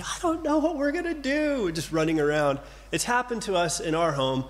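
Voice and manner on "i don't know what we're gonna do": high, frantic mock panic; panicky parent. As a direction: squeak it out like a flustered parent. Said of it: "by just freaking out and saying".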